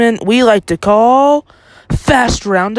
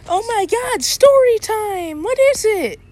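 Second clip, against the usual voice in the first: much higher, hammed-up squeal of delight; mock excitement; 13 semitones higher than usual. Cohost mock-cheers the friend's story offer, squealing high. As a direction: play it like a kid told it's story time.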